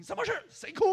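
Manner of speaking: loud, high startled questions; surprised crowd asking who cried. Retold or imitated. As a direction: jump in shock. Startled people at the scene.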